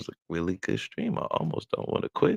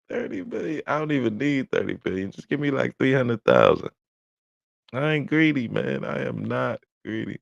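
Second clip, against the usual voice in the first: excited; 9 semitones higher than usual. Real, not acted. Laughing, joking about money.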